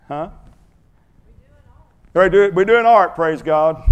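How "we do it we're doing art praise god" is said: high, loud burst of praise; excited preaching. Real, not acted. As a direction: proclaim it joyfully. Sudden loud preaching exclamation.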